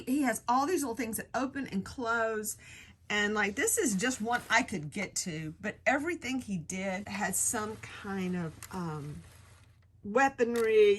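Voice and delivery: ordinary talk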